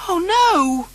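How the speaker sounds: high, loud dismayed wail; a little boy's despair. Acted. Noddy wails at losing his car for a day, high and loud. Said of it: "wailed Noddy".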